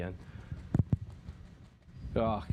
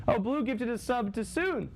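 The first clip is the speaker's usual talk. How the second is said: excited thanks; 13 semitones higher, slower than usual. Real, not acted. Thanking viewer for gifted sub.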